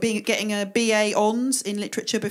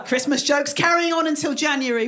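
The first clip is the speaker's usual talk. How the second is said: ordinary delivery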